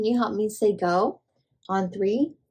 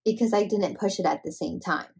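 ordinary delivery

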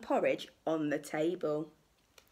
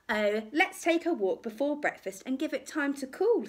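lightly voiced mother bear, pitched up; a hint of a higher motherly voice; gently acted mummy bear suggestion; 7 semitones higher than usual. A narrator's reading. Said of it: "said Mummy Bear".

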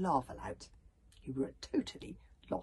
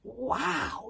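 raised-pitch, awed wow; gasped amazement; 7 semitones higher, 4 dB louder than usual. A narrator's reading. Hiccup admires the bodyguard's rescue, gasping. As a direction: gasp it in mild awe. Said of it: "gasped Hiccup".

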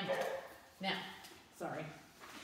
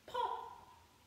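high playful pop with a laugh; a giggly high pop; sun popping. Shown as a demonstration. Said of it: "the sun goes".

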